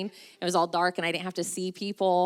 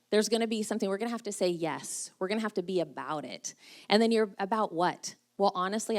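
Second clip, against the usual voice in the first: ordinary talk, nothing marked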